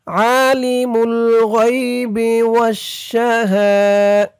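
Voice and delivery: slow, chanted tajweed recitation; Quran recitation. Shown as a demonstration. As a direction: recite it melodically for students to follow. Quran recitation lesson, teacher recites.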